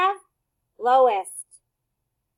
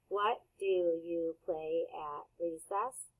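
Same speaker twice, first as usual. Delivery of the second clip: slower and softer, every word clear; slow model repeat; 7 semitones lower, 10 dB quieter, slower than usual. Shown as a demonstration. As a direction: say "What do you play at recess?" slowly for kids to echo. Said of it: "repeat that sentence".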